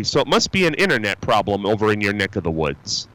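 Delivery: ordinary talk, nothing marked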